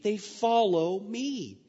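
usual speaking voice

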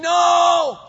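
high, loud shout; the flesh protesting, high loud scream. Acted. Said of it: "The flesh will be screaming".

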